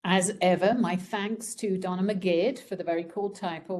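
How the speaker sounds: normal talking voice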